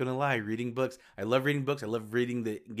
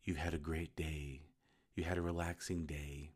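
calm soothing voice; 5 semitones lower, 7 dB quieter than usual. Retold or imitated. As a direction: soothe them gently to sleep. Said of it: "it was a very calm voice saying".

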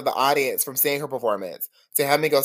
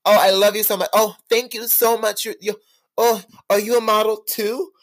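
high, sugary posh greeting; classy Naomi impression; 9 semitones higher than usual. Naomi Campbell charming starstruck fans, high and sugary. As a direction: switch on the gracious supermodel charm. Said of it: "turned on the classiest smile and wave".

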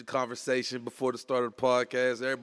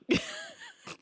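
mock pain yelp; 3 dB quieter than usual. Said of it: "That was not a bruise sound".

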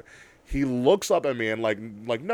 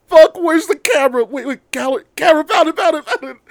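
high, loud flustered rush; frantic fumbling; 14 semitones higher, 9 dB louder than usual. Retold or imitated. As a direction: panic hunting for the camera. Panicking to find phone camera.

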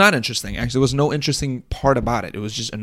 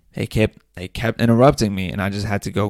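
ordinary talk

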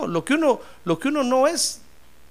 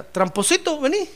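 lightly voiced parent calling a child, higher; affectionate parent calling; 8 semitones higher than usual. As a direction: call your little one over, pitched up.

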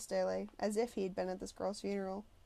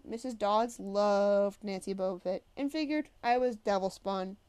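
plain reading voice

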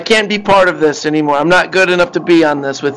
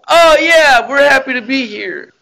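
sarcastic, high fake cheer; mock cheerful; 11 semitones higher than usual. Mocking workers' forced cheer, high sarcastic chirp. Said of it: "And we're just like".

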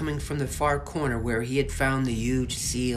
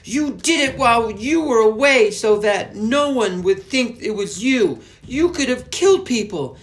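much higher, loud, outraged shout; angry accusation; 13 semitones higher, 13 dB louder than usual. Acted. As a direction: confront them in fury. Alfie accusing the witches.

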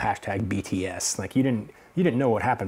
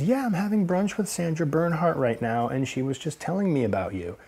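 Sally Hirschberger; 8 semitones higher than usual. Retold or imitated. Imitates woman on the phone.

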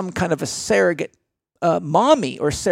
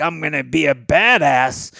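cocky recruit; 4 semitones lower than usual. Retold or imitated. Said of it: "young guys coming in like".

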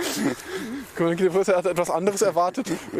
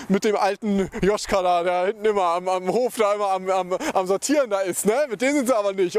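ordinary delivery

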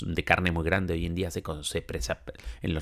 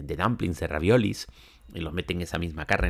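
ordinary talk

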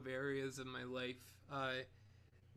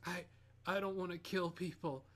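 usual speaking voice

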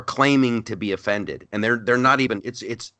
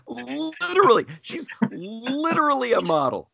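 incredulous exclamation; 13 semitones higher than usual. Retold or imitated. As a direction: squeal it in comic disbelief. Incredulous mocking of Vogue's excuse.

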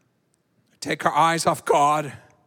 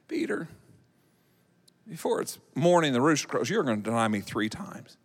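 Jesus quiet warning; 6 semitones lower, 7 dB quieter, faster than usual. Acted. Jesus predicting denial.

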